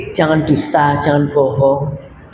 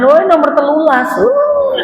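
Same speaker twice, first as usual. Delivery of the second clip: mock honest husband; 8 semitones higher than usual. Honest husband, wife's reaction.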